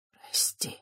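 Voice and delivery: soft unvoiced whisper. A narrator's reading. Priest whispering sorry to Christiana. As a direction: breathe the apology. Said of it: "прошептал священник".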